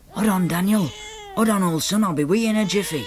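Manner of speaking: urgent call, a touch frantic. Acted. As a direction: reassure a screaming baby from the next room. Calling to crying baby.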